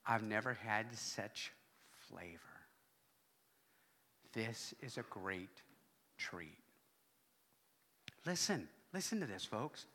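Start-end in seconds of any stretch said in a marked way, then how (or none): 0.0-6.6 mom quietly impressed. Retold or imitated. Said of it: "my mom goes".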